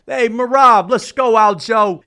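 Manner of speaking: loud, high mock coach yell from backstage; Ray Longo screaming. Corner coach yelling backstage to hype his fighter, loud mock shout. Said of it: "Ray Longo screaming backstage".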